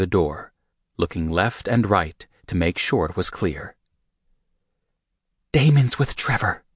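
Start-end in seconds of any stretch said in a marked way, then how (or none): 5.5-6.6 Rika whispering. A narrator's reading. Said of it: "she whispered".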